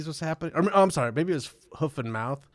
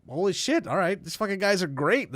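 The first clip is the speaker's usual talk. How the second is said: higher-pitched, pleasantly stunned reaction; mild mock amazement, pitched up; retold amazement; 6 semitones higher than usual. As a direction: act out being lightly bowled over. Retold amazed reaction to generous deal.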